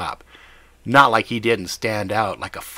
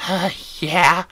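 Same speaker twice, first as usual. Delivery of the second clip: high whiny addict voice; high-pitched whiny voice; 8 semitones higher, 3 dB louder than usual. Acted. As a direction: whine it, high and thin. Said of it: "with a high-pitched, whiny voice".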